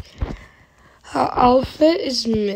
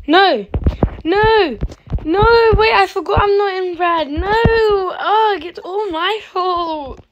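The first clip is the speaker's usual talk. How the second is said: dismayed wailing; 7 semitones higher, 3 dB louder than usual. Real, not acted. Gamer realizing they're not on the winning team, wailing. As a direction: wail in dismay at your own mistake.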